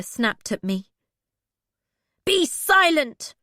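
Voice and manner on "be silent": higher, louder, slow commanding roar; father's angry roar. Acted. Bee silencing her captors, slow louder angry roar. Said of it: "I roared at them in my father's angry voice".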